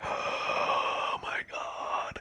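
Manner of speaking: gasping, breathy whispered amazement; hushed, drawn-out disbelief after a gasp; awestruck thinking. Retold or imitated. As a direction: gasp it out slowly under your breath. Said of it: "I'm thinking, like".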